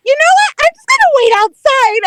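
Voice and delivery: high, loud, flustered announcement; retold flustered exit. Telling her date she is leaving, flustered. Retells giving up on shoes.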